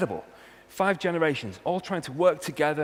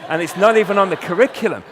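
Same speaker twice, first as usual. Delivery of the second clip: mock excitement; 6 semitones higher, 14 dB louder than usual. Mock delight, teens reading classics.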